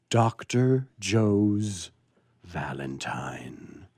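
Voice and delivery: host whispering the story title; dramatic title. Acted. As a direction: announce the title slowly, then breathe it.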